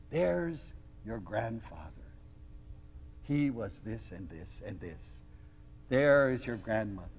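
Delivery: hushed reverence. Retold or imitated. Aunt showing family graves at the cemetery, hushed reverence. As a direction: point out each grave softly, with long pauses.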